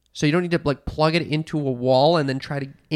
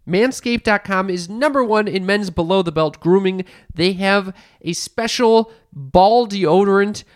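slow, upbeat ad-read voice; sponsor ad read; 5 semitones higher, 3 dB louder, slower than usual. Acted. Host pitching a sponsor to listeners, ad-read style. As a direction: sell it like a radio spot.

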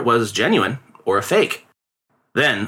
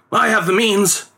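father's reply; 8 semitones higher, slower than usual. A narrator's reading. Father answers the boy confidently.

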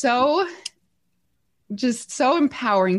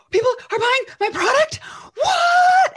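squealing high, rising to a loud What; retold shriek of surprise; shocked disbelief; 3 dB louder than usual. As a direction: act out stunned disbelief. Her disbelief at first sales.

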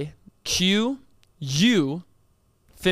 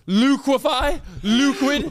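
louder, higher, fed up; exasperated loud; 7 semitones higher, 4 dB louder, slower than usual. Real, not acted. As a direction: hammer the word in, exasperated. Spelling bee, exasperated.

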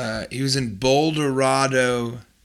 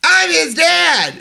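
much higher, louder hammed-up dad; high shouting dad; 5 dB louder than usual. Father shouting his claim at teacher, high and hammed-up. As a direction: yell it in a silly high voice.

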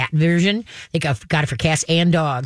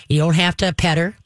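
ordinary talk

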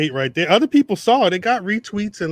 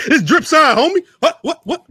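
laughing, higher and louder; 12 semitones higher, 4 dB louder than usual. Pretend. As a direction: laugh through a mock boast. Laughing gang-sign bit.

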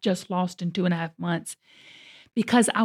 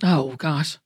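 usual speaking voice

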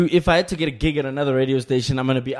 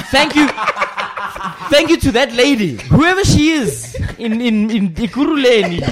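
laughing, higher and louder thanks; 6 semitones higher, 5 dB louder than usual. Real, not acted. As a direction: laugh through the thank-yous. Laughing gratitude to the listener.

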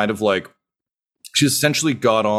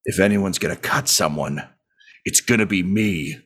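tough innkeeper growl; 3 semitones lower than usual. Acted. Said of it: "you just hear".

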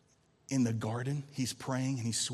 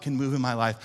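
ordinary talk

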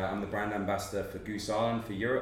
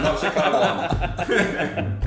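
mock Jamaican accent; 8 semitones higher, 10 dB louder, much slower than usual. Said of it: "Gotta say it with a Jamaican accent".

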